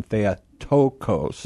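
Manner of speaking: "Theotokos" said slowly, the stress on the omicron before the last syllable; slow, deliberate classroom model of the word; model pronunciation. Shown as a demonstration. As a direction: demonstrate "Theotokos" with weight on the "to". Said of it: "the accent there on that omicron".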